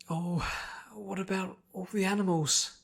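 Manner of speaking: high, anxious pony voice; a timid pony fearing for animals; gentle fretful pony. Acted. Timid pony worrying about the animals, high and anxious.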